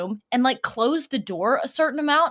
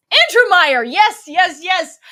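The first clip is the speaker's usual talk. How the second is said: much higher and louder, triumphant yes yes yes; excited recognition; 8 semitones higher, 10 dB louder, slower than usual. Real, not acted. Streamer finally recalls the forgotten name, loud and excited. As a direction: shout the name like you just cracked it.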